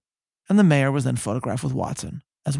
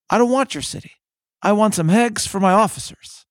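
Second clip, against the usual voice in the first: lightly voiced brusque cook, higher; a hint of a put-out soldier; dismissive private; 6 semitones higher, 4 dB louder than usual. Narrator voicing the cook turning down the city, lightly acted.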